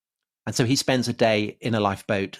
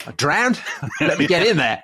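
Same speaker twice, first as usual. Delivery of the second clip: cheery, louder mock voice of a keen swimmer; mock Aussie swimmer; 6 semitones higher, 4 dB louder than usual. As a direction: play the eager Aussie diving in. Mock Australian swimmer speaking.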